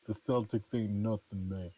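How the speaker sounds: put-on thick New England accent, joking; trash-talk in a New England accent. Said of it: "Just giving my New England accent".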